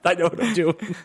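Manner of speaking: high, giggly reassurance; giggling drunk. Retold or imitated. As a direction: mimic the drunk while cracking up. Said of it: "he just kept saying".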